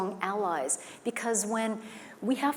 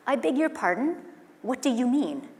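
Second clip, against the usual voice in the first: polished, raised-pitch formal English, set apart from her own voice; 5 semitones higher, 4 dB louder than usual. Retold or imitated. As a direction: put on a prim, proper tone to answer the neighbor.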